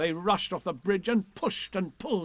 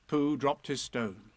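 ordinary delivery

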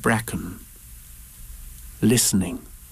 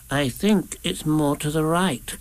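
higher, slow and hesitant little voice; nervous Piglet; 4 semitones higher, slower than usual. A narrator's reading. Piglet doubting Rabbit's route, nervous small voice. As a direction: venture it timidly, slowly. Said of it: "said Piglet nervously".

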